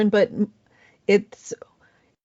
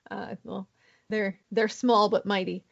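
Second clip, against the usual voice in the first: usual speaking voice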